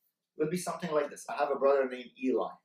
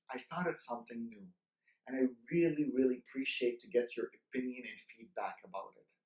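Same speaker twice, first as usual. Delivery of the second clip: soft, lower and respectful; respectful to uncle; 4 semitones lower, 7 dB quieter than usual. Shown as a demonstration. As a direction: ask the elder's opinion gently and humbly. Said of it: "did you see the tonality".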